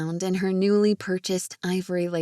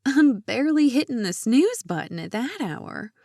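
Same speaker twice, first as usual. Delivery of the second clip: higher-pitched incredulous protest; shocked; 5 semitones higher, 4 dB louder than usual. A narrator's reading. Lydia hearing how early the appointment is, shocked and high. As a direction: react aghast, pitch jumping up. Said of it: "Lydia blinked her eyes in shock".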